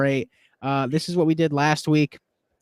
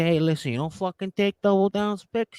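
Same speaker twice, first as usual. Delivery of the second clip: higher-pitched put-on movie tough-guy accent; Rocky Marciano; 4 semitones higher than usual. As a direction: play an offended film heavy with a comic accent. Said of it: "Get me in a film with a fucking accent".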